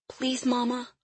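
high, soft, frail plea; weak pleading voice. Acted. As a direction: beg faintly like a scared child. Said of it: "she cried in a weak voice".

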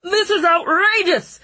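high, loud indignant snarl; snarling outrage. Acted. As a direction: spit out the outrage. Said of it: "Ratcliffe snarled".

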